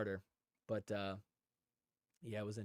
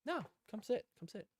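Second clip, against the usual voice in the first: friendly invite; 8 semitones higher, 5 dB quieter than usual. Retold or imitated. Welcoming stranger to seat.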